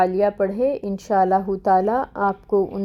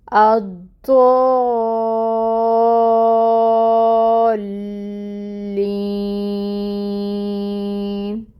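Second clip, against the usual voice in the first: long-held recitation; much slower than usual. Shown as a demonstration. Teacher reciting from al-Fatiha, the madd held long. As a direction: stretch the madd of الضالین at length.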